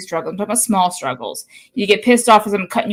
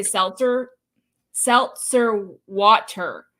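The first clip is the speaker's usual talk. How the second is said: slow, careful imitation of Florida "seltzer water"; Florida accent; much slower than usual. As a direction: sound every R in "seltzer water", Florida style. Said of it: "the way they say it down here in Florida".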